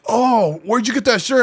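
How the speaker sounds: a hint of a surprised lady; quoted lady customer. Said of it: "the lady was like".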